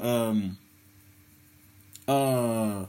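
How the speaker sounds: ordinary talk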